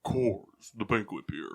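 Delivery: deep, low voice on the slogan. Shown as a demonstration. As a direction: drop the pitch for a deep beer-slogan read. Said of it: "but with a deeper voice?".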